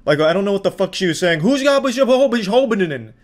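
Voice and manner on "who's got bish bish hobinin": high, garbled sputtering in imitation; too worked up to get the words out; mock-angry, high-pitched babble; mock gibberish. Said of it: "It's like some gibberish Adam Sandler".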